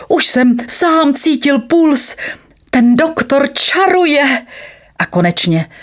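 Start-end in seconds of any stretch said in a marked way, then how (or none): 0.1-4.5 high, slow elated announcement; joyful calling. A narrator's reading. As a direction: call it out with growing wonder. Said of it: "radostně volal". (radostně, vysoko a protáhle)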